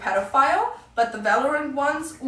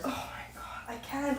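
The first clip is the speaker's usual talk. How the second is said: hushed, voiceless disbelief; barely voiced, overwhelmed aside; exasperated whisper; 12 dB quieter than usual. Real, not acted. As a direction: whisper it as if you can't cope. Overcome by the girls' behaviour.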